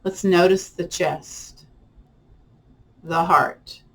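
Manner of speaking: calm, unhurried voice with long pauses between phrases; guided meditation. Acted. Leads a guided body-scan meditation.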